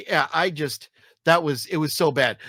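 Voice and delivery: ordinary talk, nothing marked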